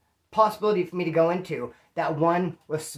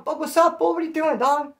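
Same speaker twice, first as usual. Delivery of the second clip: much higher, calling out to a buddy; 9 semitones higher than usual. Retold or imitated. Greeting his old friend Tom across the row, excited. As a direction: shout hello like you spotted your homie.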